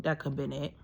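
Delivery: whispered aside, under her breath; breathy muttered afterthought. Real, not acted. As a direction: drop to a whisper as a side thought.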